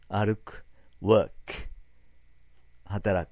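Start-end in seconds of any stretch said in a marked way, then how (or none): 1.0-1.3 "work" with a murky middle vowel, "ワーク"; "work" said with a short "ワ" sound; workの発音. Shown as a demonstration. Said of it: "よく間違うのはね". (「work」はあいまいな「ワ」の音で)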